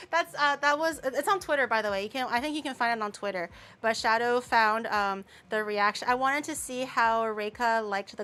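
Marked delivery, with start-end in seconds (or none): none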